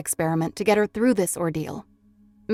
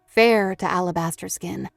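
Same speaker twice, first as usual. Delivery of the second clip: ordinary delivery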